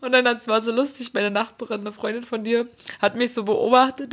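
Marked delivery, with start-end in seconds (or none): none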